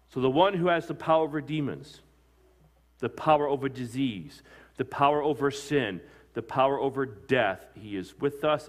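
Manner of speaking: rising pulpit cadence on each power; building sermon climax. Acted. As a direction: preach the list with mounting force. Preacher building a list.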